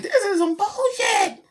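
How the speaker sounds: high, drawn-out indignant squeal; mock outrage. Retelling own reaction to listeners, high mock outrage. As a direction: voice shoots up in slow comic protest.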